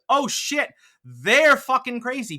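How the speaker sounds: high, loud alarmed gasp of realization; shocked realization. Retold or imitated. Describing talking to someone crazy, loud shocked realization. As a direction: suddenly realize they're nuts.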